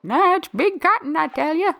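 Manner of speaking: put-on high, cranky old-timer voice; old man voice. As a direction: grumble it like a crotchety grandpa. Voicing grumpy old George.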